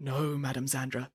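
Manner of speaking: small, very soft reply; humble. A narrator's reading. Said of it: "said Nanny Piggins humbly".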